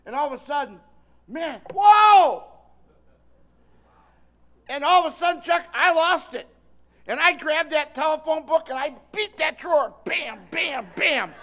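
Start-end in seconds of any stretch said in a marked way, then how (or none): 1.3-2.4 higher, loud whoa as temper snaps; startled loud shout of anger; loud outburst. Retold or imitated. Losing his temper in story. 4.7-9.9 louder, higher, building heat; raised voice reliving the rage; heated retelling. Retold or imitated. Retelling losing his temper. 10.1-11.3 mock bangs. Imitating beating the drawer.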